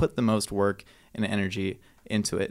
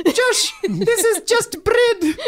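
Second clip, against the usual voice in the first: put-on very high, slow, laughing old grandma voice; hammed-up grandma quoting her grandson; grandma impression; 6 dB louder, much slower than usual. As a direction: squeak it out like a tearful granny, cracking up. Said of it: "That's not what she sounds like".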